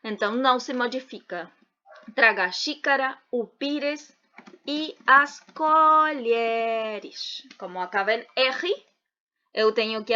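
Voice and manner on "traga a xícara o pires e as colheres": "e as colheres" very slowly, vowels stretched long; drawn-out dictation. Shown as a demonstration. Teacher forming the plural for students, "colheres" drawn out slowly. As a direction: stretch out each syllable of "colheres".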